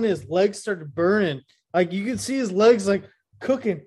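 ordinary talk, nothing marked

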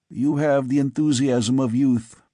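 ordinary delivery, nothing marked

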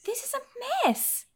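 high-pitched, incredulous exasperation; exasperated outcry. Real, not acted. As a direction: protest in high, drawn-out disbelief. Exasperated rant at co-host.

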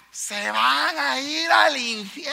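slow, hammered-out shout; drawn-out preacher's shout of doom; fiery preacher shout. Retold or imitated. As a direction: blast it at them like a hellfire preacher. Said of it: "pegan los cuentazos directos".

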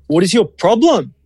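acted disbelief, voice jumping up; exasperated retort. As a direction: take a dismissive copywriter to task. Acted retort to dismissive staff.

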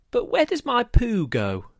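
put-on high child's voice, falling at the end; polite child's question. A narrator's reading. John asking Daddy an awkward question, high polite child. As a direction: ask high and earnest, like a curious boy. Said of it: "he would politely inquire".

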